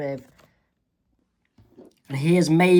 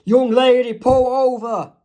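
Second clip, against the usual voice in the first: loud, higher cop order; police command; 6 semitones higher, 6 dB louder than usual. Acted. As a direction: bark at the driver to stop. Police orders driver to stop.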